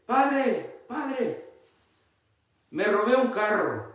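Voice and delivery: hammed-up thief calling the priest; thief confessing. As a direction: play the car thief pleading with the priest. Thief confessing in joke story.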